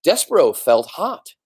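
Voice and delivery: ordinary delivery, nothing marked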